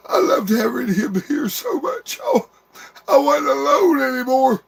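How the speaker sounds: high, breaking voice fighting through tears; crying out grief for a lost brother; real sobbing. Real, not acted. Brother speaking to his dead little brother, sobbing.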